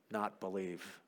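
quiet, sunken echo of a vow; haunted echo. Acted. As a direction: let the words come back quietly, low. Echoes words that came back.

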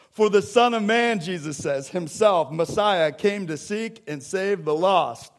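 preacher declaring salvation to the congregation; full-force preaching. Acted. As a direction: proclaim it to the whole church.

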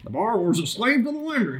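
excited, high, quick catchphrase impression; Dave Ramsey. Group leader imitating a show host's every-episode line, excited impression. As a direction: fire off the catchphrase fast and high-pitched. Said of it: "He says it, like, every episode".

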